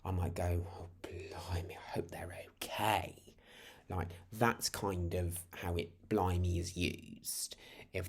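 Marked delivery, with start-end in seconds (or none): 0.7-3.1 hushed, drawn-out worried exclamation; concerned shock. Shown as a demonstration. Hearing something really bad has happened, hushed concern. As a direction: breathe it out softly with concern. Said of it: "if something really bad's happened".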